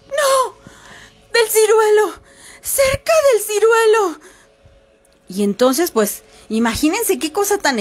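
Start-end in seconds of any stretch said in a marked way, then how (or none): 0.1-4.2 high, wailing, weepy plea from far off; a buried woman's cry rising from the well; ghostly woman crying for help. Acted. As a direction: wail like a trapped ghost sobbing. The trapped woman answers.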